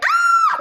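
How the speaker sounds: high, loud startled shriek; mock scream. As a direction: shriek as if spooked. Person scared by demon.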